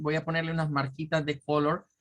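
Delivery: ordinary talk, nothing marked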